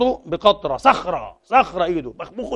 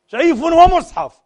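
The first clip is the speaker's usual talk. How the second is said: much higher, louder, slow jeer; mocking slogan; 11 semitones higher, 8 dB louder, much slower than usual. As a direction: bellow it like a fanatic, in scorn. Slogan mocked again. (سيف ومصحف بصراخ ساخر بطيء)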